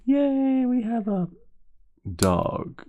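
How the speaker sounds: very high, loud squeal of delight; imitated gleeful kid voice; happy kids. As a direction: cheer like thrilled children. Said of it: "the kids were happy".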